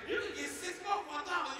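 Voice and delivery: a high, loud cashier voice; hammed-up high sales pitch; imitating donut lady. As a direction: call out the deal like a cashier. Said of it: "the lady says some shit like this".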